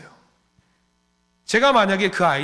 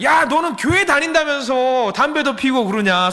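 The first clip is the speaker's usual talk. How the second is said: put-on tattling kid; 9 semitones higher, 5 dB louder than usual. Kids tattle on each other.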